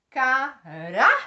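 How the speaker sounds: "caráter" slow and loud, syllable by syllable; "caráter" as ca, RÁ, ter; stress on penultimate syllable. Shown as a demonstration. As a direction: punch a rising RÁ in "caráter". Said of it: "Olha o rá sendo a sílaba mais forte".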